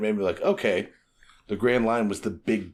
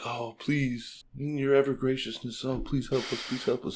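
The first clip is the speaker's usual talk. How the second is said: softer, pleading beg; mock groveling; 4 semitones higher, 5 dB quieter than usual. Crew begging for a log pose, softer mock pleading. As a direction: grovel for help. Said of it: "it's just".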